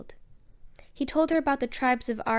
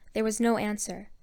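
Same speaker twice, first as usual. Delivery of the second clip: plain reading voice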